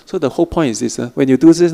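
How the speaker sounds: ordinary talk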